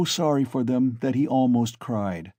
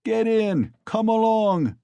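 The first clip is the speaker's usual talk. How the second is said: laughing crowd; 11 semitones higher, 4 dB louder, slower than usual. A narrator's reading. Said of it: "the crowd laughed".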